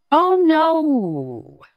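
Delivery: high, loud, falling wail of dismay; long swooping cry; tickled tiger's wail. Acted. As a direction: cry out in drawn-out protest. Tiger tickled once more.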